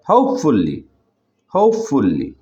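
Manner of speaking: Hopefully with হোপ pressed, then falling; careful model of hopefully, said twice; stressed syllable demo. Shown as a demonstration. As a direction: lean on the first syllable of hopefully. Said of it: "ঠিক একইভাবে".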